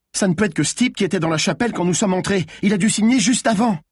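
high-pitched, urgent rush of words; excited alarm. A narrator's reading. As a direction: blurt it out fast and high. Malines excitedly works out the culprit.

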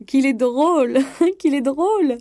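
crying out through high, loud laughter; hysterically amused, voice soaring; crazy laughter. A narrator's reading. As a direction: shriek with helpless mad laughter. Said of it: "rire comme une folle, criant".

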